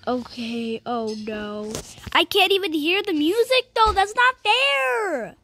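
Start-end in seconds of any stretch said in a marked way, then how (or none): 2.1-5.3 high, stretched-out complaint; whiny protest. Real, not acted. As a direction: wail about the unfairness, dragging the end. Protesting that game is unfair.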